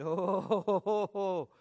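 long, loud, pulsing "oh"; overwhelmed moan. Retold or imitated. Preacher replays answering Susan while overcome, overwhelmed wail. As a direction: wail it out in mock blubbering. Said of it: "I'm blubbering, I'm crying".

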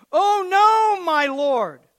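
high, loud panicked cry; frightened cry. Acted. Servant crying out in panic to the prophet. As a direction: cry out in terror at the army. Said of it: "the servant cried out".

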